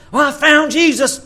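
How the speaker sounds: high, loud mock-eager convert. Mocking shallow convert.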